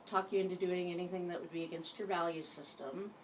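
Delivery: ordinary delivery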